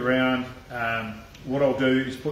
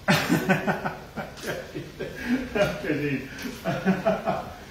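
long, high-pitched giggling laugh; helpless run of high laughter; real laughing fit; 5 semitones higher than usual. Real, not acted. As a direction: crack up and keep on laughing. Cracks up mid-cooking.

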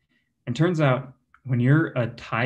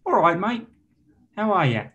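higher-pitched, matey Australian greeting; Australian accent; 6 semitones higher than usual. Shown as a demonstration. Comic shows off accents to the crowd, cheery Australian. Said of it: "How about Australian?".

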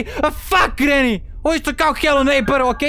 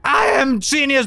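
grandiose boast; 4 semitones higher, slower than usual. Pretend.

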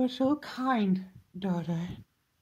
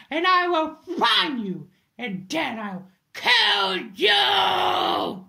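higher, louder, slowing into a shouted threat; furious threat; 7 semitones higher, 6 dB louder, slower than usual. Acted. Vowing to hunt down Ethan, furious shout. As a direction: spit the promise to kill him.

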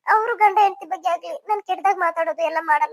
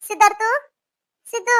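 raised, higher call from the doorway; 4 semitones higher, 3 dB louder than usual. Acted. Aunt calls nephew from outside the door, calling out. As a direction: call for someone inside the house. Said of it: "ಇಲ್ಲಿಂದಾನೇ ಕರಿತೀನಿ".

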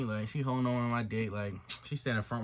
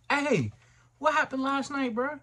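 confronting; 12 semitones higher, 4 dB louder, slower than usual. Retold or imitated. Acted confronting G next morning.

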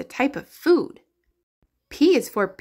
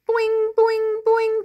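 high, bouncy boings; playful boing; 11 semitones higher than usual. Pretend. Teacher acting out a hopping kangaroo, high cartoon boings.

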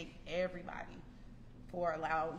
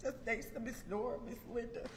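higher, louder, voice cracking with real tears; pushing words out through sobs; crying through thanks; 5 semitones higher, 6 dB louder than usual. Real, not acted. Graduate thanking her mentors at the ceremony, crying. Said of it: "I've been crying".

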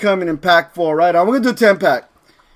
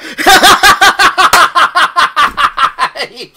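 long high cackling laugh; helpless laughter; 7 semitones higher than usual. Real, not acted. Streamer fooled by a fake account, laughing hard. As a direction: laugh until the air runs out.

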